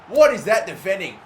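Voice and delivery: usual speaking voice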